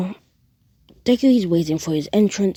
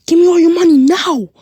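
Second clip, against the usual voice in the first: very high, loud demand; robber's shout; 13 semitones higher, 11 dB louder, faster than usual. Acted. Robber pointing a gun at the man, shouting. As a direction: yell it like a gunman.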